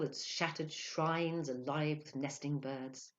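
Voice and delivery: plain reading voice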